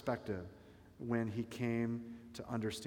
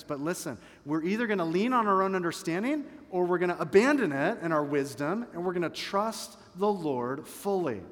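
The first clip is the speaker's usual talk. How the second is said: louder, driving sermon voice; full-force preaching; 3 semitones higher, 5 dB louder than usual. Acted. Pastor urging the congregation to trust God, preaching hard. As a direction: drive the point home to the congregation.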